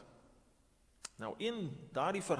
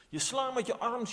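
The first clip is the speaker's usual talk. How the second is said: ordinary talk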